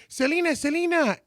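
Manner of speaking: high eager call of her name. Retold or imitated. Dad calling to the star across the lobby, high and eager. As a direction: call out to grab her attention.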